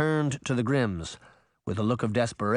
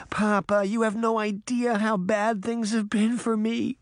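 crying; 10 semitones higher than usual. A narrator's reading. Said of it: "Pinocchio cried".

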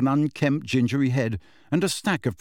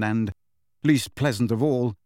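ordinary delivery, nothing marked

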